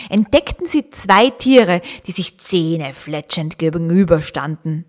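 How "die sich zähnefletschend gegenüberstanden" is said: usual speaking voice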